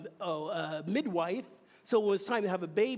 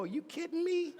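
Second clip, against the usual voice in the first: incredulous retort; 10 semitones higher than usual. Retold or imitated. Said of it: "And I'm going".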